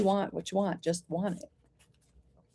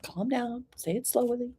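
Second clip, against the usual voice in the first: high, soft mock-soothing mother; hushed, higher-pitched parental voice; imitating mother; 5 semitones higher, 8 dB quieter than usual. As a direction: imitate a mother calming an upset child. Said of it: "My mother used to say".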